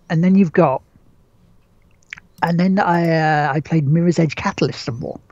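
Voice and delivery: ordinary talk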